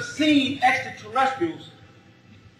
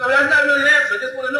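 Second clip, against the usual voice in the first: ordinary talk, nothing marked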